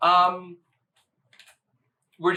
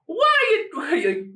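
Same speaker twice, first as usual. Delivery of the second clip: high, slow, exaggerated Australian accent; drawn-out over-the-top Australian accent; 14 semitones higher, 3 dB louder, much slower than usual. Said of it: "in the most Australian accent ever".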